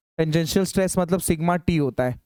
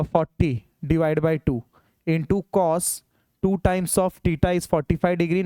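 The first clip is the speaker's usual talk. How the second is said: slow dictation; much slower than usual. Shown as a demonstration. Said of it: "teacher dictates slowly".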